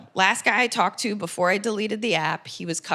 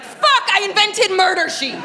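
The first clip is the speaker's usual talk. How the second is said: high, loud, slow laughing cry of horror; 10 semitones higher, 5 dB louder, slower than usual. Retold or imitated. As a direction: shriek it through a laugh at your own disaster. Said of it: "I was like".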